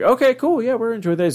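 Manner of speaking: put-on high-pitched kid going along happily; mock-cheerful high kid voice; kids' eager agreement. Kids chirpily answering their dad.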